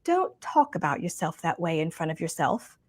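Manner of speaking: soft, quiet imitation of a British accent; sweet British accent. Retelling a mentor's advice about ugly self-talk, sweet British accent. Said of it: "in her sweet British accent".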